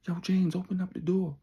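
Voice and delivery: calling through the door. Retold or imitated. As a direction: shout it at the closed door.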